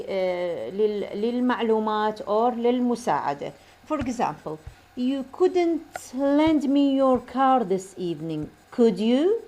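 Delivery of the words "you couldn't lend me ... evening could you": "could you" lifts at the end; slow model sentence, rising on "could you"; polite request, voice climbing on "could you"; rising intonation. Shown as a demonstration. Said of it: "تلاحظون هاي rising intonation".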